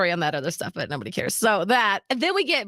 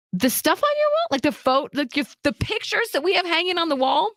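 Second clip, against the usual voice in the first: high-pitched baffled disbelief; incredulous questioning; 9 semitones higher than usual. Retold or imitated. Daughter questions mom about missing wall pictures, incredulous. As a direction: reenact a bewildered question, pitched way up.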